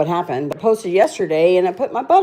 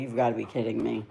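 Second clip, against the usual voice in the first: soft and quick, mortified; hushed, mortified disbelief; embarrassed mumble; 7 semitones lower, 11 dB quieter, faster than usual. Retold or imitated. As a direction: rush it out under your breath. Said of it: "I was so embarrassed".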